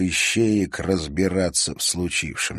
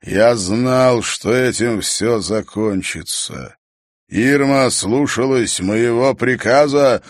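slow, loud booming voice; a vast angry voice from beyond; echoing ethereal energy being; 4 semitones higher, 6 dB louder, slower than usual. A narrator's reading. Mirror being scolds witch. (гулкий гневный голос)

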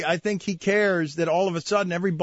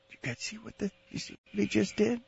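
very soft hushed aside; hushed whisper; 7 dB quieter, faster than usual. Pretend. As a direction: lean in and hush it.

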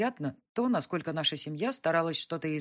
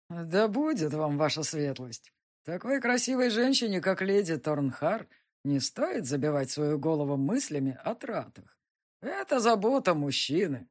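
put-on drunk, slurring his words; tipsy nobleman, tongue tangling; slurred cynical barfly. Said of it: "заплетающимся языком сказал граф Гилроу".

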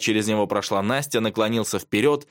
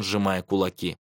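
plain reading voice

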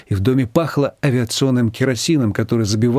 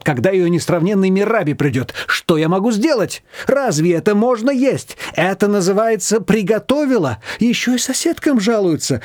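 higher, indignant complaining; mild indignation; 7 semitones higher than usual. A narrator's reading. Nina complaining about Manana, indignant. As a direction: vent about the mother-in-law. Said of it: "от возмущения даже руками начала размахивать".

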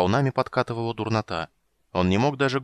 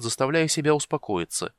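ordinary delivery

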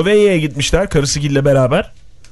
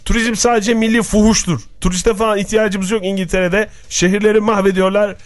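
voicing Prince Philip; 6 semitones higher than usual. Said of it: "demiş".

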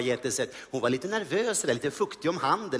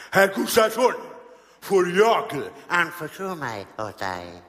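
slow, theatrical confiding tone; the grand actor leaning in to confide; Ernst-Hugo voice; slower than usual. Retold or imitated. Comedian as Ernst-Hugo, slow theatrical confiding. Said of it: "säger han".

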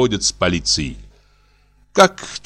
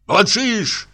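high, raised angry shout; 12 semitones higher than usual. A narrator's reading. Husband shouting at his silent wife. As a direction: yell it at her. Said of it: "закричал супруг".

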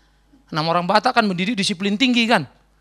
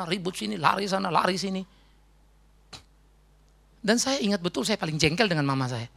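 ordinary delivery

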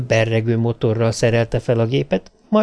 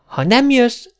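put-on much higher child's threat, falling at the end; threatening; 13 semitones higher, 3 dB louder, slower than usual. A narrator's reading. Little girl playing her last card, threatening. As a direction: lay down an ultimatum in a child's voice. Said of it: "fenyegetődzött".

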